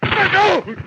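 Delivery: sharp, high, loud cry of pain; high shout of pain; pained yell. Acted. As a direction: yell as your arm is wrenched. Man's arm twisted in a fight.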